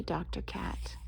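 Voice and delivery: weak sad whisper. Acted. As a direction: whisper it sadly. Miserable whisper.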